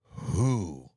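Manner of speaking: who, dropped low like an owl's hoot; mock owl who. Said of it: "I sounded like an owl".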